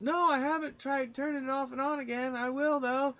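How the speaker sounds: put-on very high, loud old-lady voice; imitated grandmother; grandma impression. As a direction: answer earnestly as grandma in falsetto. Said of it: "and she goes".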